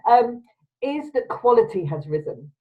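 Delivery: usual speaking voice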